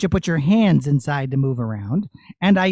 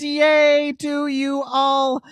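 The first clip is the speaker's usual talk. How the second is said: put-on high, bright-pitched host cheering the kids on; rally the audience like a show host; cheery host voice; 9 semitones higher, 4 dB louder than usual. Host puppet addresses audience.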